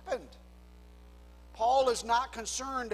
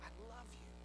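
tender God voice; 10 dB quieter than usual. Acted. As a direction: hush it down and speak like God consoling a sinner. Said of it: "God says".

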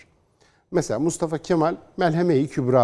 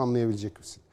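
normal talking voice

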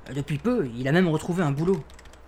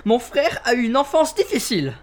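loud, high-pitched indignation; defensive outburst; 9 semitones higher, 4 dB louder than usual. Acted. Suspect defending his brother from mockery, indignant. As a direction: leap to your brother's defence.

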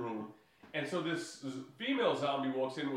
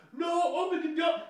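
much higher, louder panicked shout; desperate yell; 6 dB louder than usual. Retold or imitated. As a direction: yell it as the door shuts on you. IT guy yelling as zombies come.

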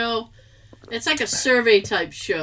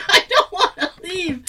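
higher, laughing plea; laughing whine; 9 semitones higher, 3 dB louder than usual. Retold or imitated. Not wanting to leave the warm shower, laughing whine. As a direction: whine it through giggles. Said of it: "And I'm like".

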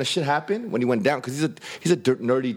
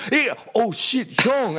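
startled recognition; 8 semitones higher, much slower than usual. Retold or imitated. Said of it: "He goes, he goes".

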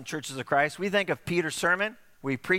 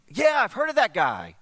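high, louder excited yeah; eager reply; 7 semitones higher, 7 dB louder than usual. Acted. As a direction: perk up at the news. Man on mat answers friend.

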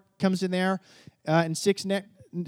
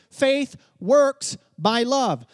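very slow, louder, each word weighted; slow emphatic scripture; 4 semitones higher, 5 dB louder, much slower than usual. Acted. Preacher quoting Galatians, slow and emphatic. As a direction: lay each word down like a verdict.

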